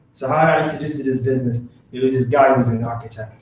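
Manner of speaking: normal talking voice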